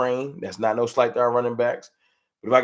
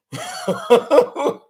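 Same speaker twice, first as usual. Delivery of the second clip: high burst of laughter; real laugh; 6 dB louder than usual. Real, not acted. Streamer laughing to chat about Henny hangovers, a high burst of laughter. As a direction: laugh it off, bright and sudden.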